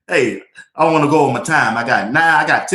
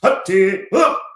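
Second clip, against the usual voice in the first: soldier march call; 4 semitones higher, slower than usual. Retold or imitated. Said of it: "come up like a soldier".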